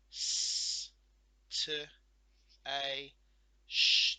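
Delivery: S, T, A, Sh each sounded loud and separate, Sh hissed hard; segmenting sounds. Shown as a demonstration. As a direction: sound out each letter one by one. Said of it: "let's look at our word station".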